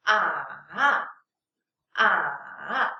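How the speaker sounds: "Ah" dipping low, then rising; full third tone. Shown as a demonstration. Teacher showing learners the third tone, full dip and rise on "ah". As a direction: let "ah" sink to the bottom, then lift up. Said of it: "pronounce the third tone fully".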